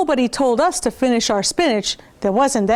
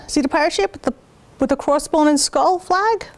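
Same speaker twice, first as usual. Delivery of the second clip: plain reading voice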